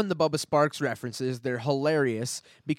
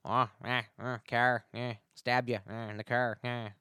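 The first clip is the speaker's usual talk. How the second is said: bad Newfoundland accent; 2 semitones lower than usual. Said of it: "a bad Irish accent for a Newfie".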